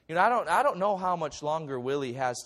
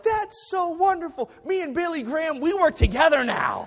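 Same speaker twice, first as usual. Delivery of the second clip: much higher and louder, hammed-up glee; mock giddy brag; 14 semitones higher, 9 dB louder than usual. Pastor joking to congregation about teaming with Billy Graham, hammed-up giddy brag. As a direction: gush like a starstruck fan, tongue in cheek.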